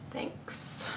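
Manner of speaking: breathy cheeky whisper; whispered thanks. Real, not acted. Cheekily thanking the publisher, whispered. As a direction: whisper it sweetly.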